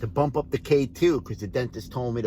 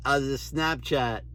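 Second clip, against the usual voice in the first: ordinary delivery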